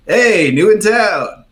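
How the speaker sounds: high, loud, drawn-out greeting; calling out. Acted. Sailor waves over the newcomer, calling out. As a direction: sing out a hail across the bar.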